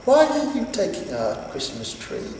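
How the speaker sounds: put-on high, innocent small child voice; a tiny girl puzzled and pleading; little girl asking. Cindy Lou Who questioning Santa, high innocent child. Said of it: "She stared at the Grinch and said".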